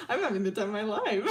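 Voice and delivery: quick words squeezed through real laughter; laughing glee. Real, not acted. Enjoying the storytelling, breathless laughing glee. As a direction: say it while cracking up.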